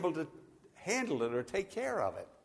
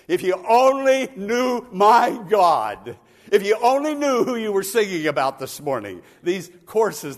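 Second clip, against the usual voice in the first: loud, higher preaching, ringing out each need; full-force preaching; 4 semitones higher, 11 dB louder than usual. Real, not acted. Preacher calling out to the congregation, loud and high. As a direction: preach it out to the back row.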